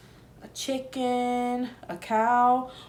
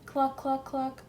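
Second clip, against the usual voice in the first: pig clucking; 3 semitones higher, 6 dB quieter than usual. A narrator's reading. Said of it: "he closed his eyes and said".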